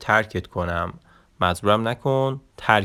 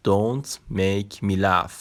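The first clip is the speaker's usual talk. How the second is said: slow, even model sentence for learners; slow full-sentence demo; much slower than usual. Shown as a demonstration. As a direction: say "Don't make me laugh." very slowly as one phrase. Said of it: "نگاه کن". (جملهٔ کامل، خیلی آهسته)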